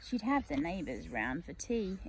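plain reading voice

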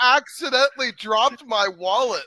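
high, loud coquettish voice; coy seductress. Pretend. Teasing Smokey with a staged wallet drop, high coquettish. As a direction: lure him in with a high coy purr.

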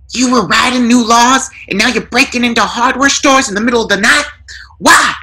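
rising outrage at the intruders; high angry burglar. A narrator's reading.

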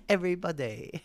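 Everybody in a put-on English accent through laughter; mock bad English accent. Streamer repeating a friend's bad English accent, laughing. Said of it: "The way she says body is so bad".

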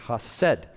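hased with the second syllable stressed; ha-SED, final syllable emphasized; stress on last syllable. Shown as a demonstration. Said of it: "emphasis on a syllable would be that last syllable".

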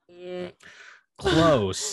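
drawn-out hum into a very high, loud close; playful sing-song correction; mock teasing. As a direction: tease her gently for the wrong word.

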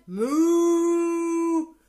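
loud mooing cow call; big hammed-up farmyard moo. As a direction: bellow it out like a cow. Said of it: "And the cow said".

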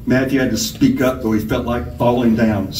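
ordinary delivery

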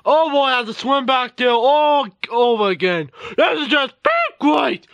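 usual speaking voice

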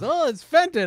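high, loud mock gush over a fake vase; mocking Mike. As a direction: ham up a clueless seller's excitement. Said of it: "Mike Death Pile Picker is, like, holding up".

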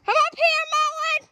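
high loud call. Real, not acted. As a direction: shout down the trail. Calling friend up trail.

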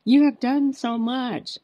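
reassuring daughter. Retold or imitated. Daughter comforting her crying mother, slow and tender. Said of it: "she's like".

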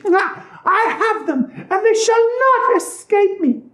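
very high, gloating witch voice; triumphant witch. A narrator's reading. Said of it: "said triumphantly".

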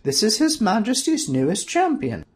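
high, humble, deferential; meekly presenting; humble sorceress. A narrator's reading. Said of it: "curtsied and said humbly".

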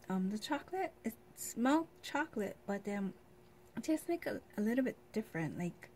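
candy at the lips; chewing marshmallow candy; talking while eating. Real, not acted. Seen 2: Repeatedly bites the candy held at her lips while talking.

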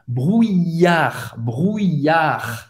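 "Brouillard" with the middle ee stretched out; "brouillard" slowly, lingering on the I glide; long I. Shown as a demonstration. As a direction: draw out "brouillard" as broo-eee-yar. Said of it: "como se fosse um I longo".

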